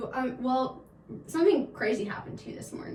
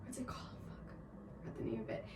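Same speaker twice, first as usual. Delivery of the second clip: near-silent whispered aside; whispered to herself; 3 semitones lower, 18 dB quieter than usual. Real, not acted. Co-host forgets the website name, whispering to herself. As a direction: whisper it as she fumbles for the name.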